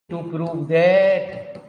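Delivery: very slow, long-held words; heavily stretched dictation. Real, not acted. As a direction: read it out as you write, dragging each word. Reading out while writing.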